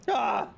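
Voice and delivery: short, high "ah"; sudden high yelp of terror; acted scream. Letter writer overcome by the horrors, acted scream.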